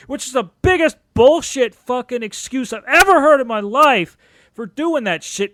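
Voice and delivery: building to a loud furious rant; real angry rant. Real, not acted. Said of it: "I'm just really angry".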